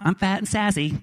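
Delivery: lightly voiced, cheeky old mother; salty mom. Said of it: "she's very salty and she knows it".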